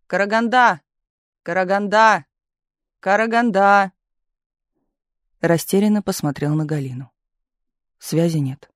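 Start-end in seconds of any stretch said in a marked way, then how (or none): 0.1-3.9 raised, higher calling voice. A narrator's reading. Chief calling Karaganda for a connection, calling out. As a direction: call it out to get through.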